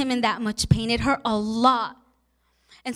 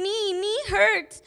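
put-on higher, drawn-out little-kid complaint; imitating tearful toddler; 7 semitones higher, slower than usual. Aunt quoting her tearful two-year-old nephew, imitated hurt toddler. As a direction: mimic a small child's slow, high whine of pain. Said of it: "tears coming down his cheeks and saying".

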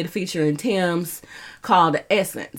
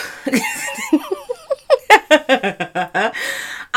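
high laugh; 12 semitones higher than usual. Real, not acted. Laughs after dancing.

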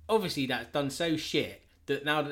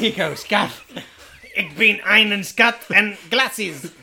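louder, slow, hammed-up German accent; bad German accent; 6 semitones higher, 11 dB louder, slower than usual. Nightcrawler handing Scott glasses in a bad German accent. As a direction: chew it like a cartoon German. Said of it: "I can't do a German accent".

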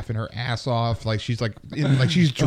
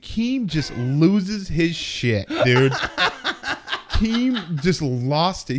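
slow, laughing high words; 5 semitones higher, much slower than usual. Real, not acted. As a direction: tell it through laughter. Laughing while telling.

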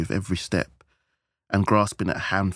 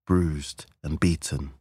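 usual speaking voice

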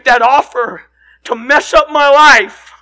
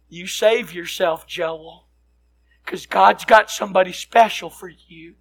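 Southern mother; 6 semitones lower, 6 dB quieter than usual. Retold or imitated. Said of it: "my mother's very Southern".

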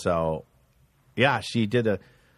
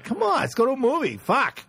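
high, exasperated pleading; mock frustrated boyfriend; impatient coaxing; 11 semitones higher than usual. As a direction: nag your girlfriend to come out. Retold pleading with girlfriend.

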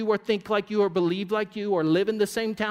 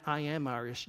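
quietly imitating a proud claimer; a low, smug little boast; mock claim; 4 semitones lower, 7 dB quieter than usual. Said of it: "you're claiming that".

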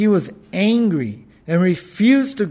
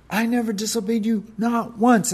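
higher, quick accusing protest; a whiny higher accusation; accusatory; 6 semitones higher than usual. Acted. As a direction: jab it like a wounded son. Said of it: "accusatory kind of tone".

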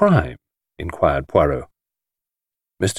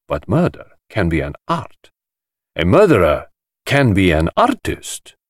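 theatrical host; 3 semitones higher than usual. Acted. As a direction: relish every word like a showman. Voicing the host character.

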